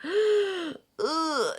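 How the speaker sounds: high exasperated groan; annoyed ugh. Retold or imitated. Annoyed at forgetting the wallet, exasperated ugh. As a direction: huff at yourself over the wallet. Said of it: "we're so annoyed at ourselves".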